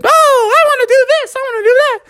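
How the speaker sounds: put-on loud, very high eager kids; clamoring kids. Kids all choosing a ride, excited high clamor. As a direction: squeal over each other's wishes, high and loud. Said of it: "And they all said".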